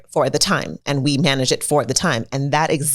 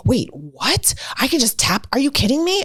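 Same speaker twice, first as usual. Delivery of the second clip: put-on amazed kid; 10 semitones higher than usual. A kid hearing about tapping, amazed and rising high. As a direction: whisper rising to high disbelief.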